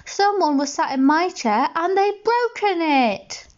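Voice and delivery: put-on high, slow baby bear voice; little bear, sorry for its broken chair; tiny upset cub. A narrator's reading. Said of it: "said Baby Bear".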